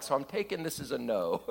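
dry quip through a laugh; deadpan joke while laughing; laughing wry aside. Retold or imitated. Jonathan dodging the spear, a wry quip through laughter. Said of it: "So Jonathan's like".

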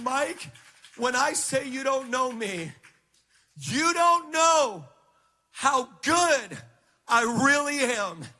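high, swelling declaration, drawn out and loud at the peak; emphatic voice of God. Retold or imitated. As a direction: build to an impassioned, ringing proclamation. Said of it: "He said it just like that".

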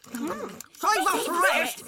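very high, slow squeal; squeaky cartoon voices calling out; clamouring fruits. A narrator's reading. Fruits want their share of the boy, squealing. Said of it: "said the fruits".

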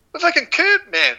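retold high-pitched exclamation; mock high, exasperated yelp; exasperated outburst. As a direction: re-enact a crash protest, voice shooting way up. Retelling exasperated outburst after crash.

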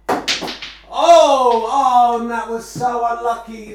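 loud, high dismay; excited groan. Real, not acted. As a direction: cry out at the miss. Unlucky miss in commentary.

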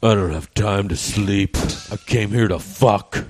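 slow, deliberate creep line; deadpan slow-burn delivery. Pretend. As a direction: drag out each word like a sleazy pickup. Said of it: "do yours".

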